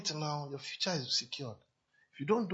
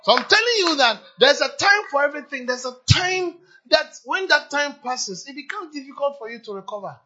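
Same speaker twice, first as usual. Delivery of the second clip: high, loud preaching at full pitch; full-force preaching; 9 semitones higher, 14 dB louder than usual. Real, not acted. Preacher urges the young congregation, preaching loudly. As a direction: hammer it home from the pulpit.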